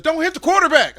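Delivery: high, loud coach order; coach shout. Retold or imitated. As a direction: call it out like a coach.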